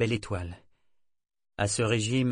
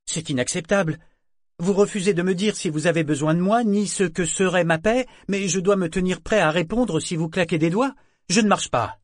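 higher, louder outburst of protest; indignant refusal; 8 semitones higher, 6 dB louder than usual. A narrator's reading. Job-seeker refusing the shipping agent's vague terms, indignant. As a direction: push back hard, pitch and volume up.

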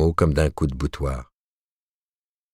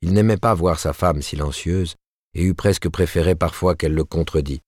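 plain reading voice

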